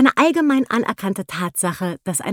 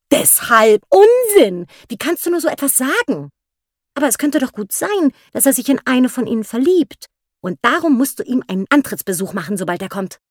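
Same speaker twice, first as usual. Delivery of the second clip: empörtes Unsinn, dann drängend; indignant Mrs Bennet; 6 semitones higher, 4 dB louder than usual. A narrator's reading. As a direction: erst empört, dann überreden. Wife rebukes, then urges. (Ehefrau weist den Mann zurecht und drängt ihn)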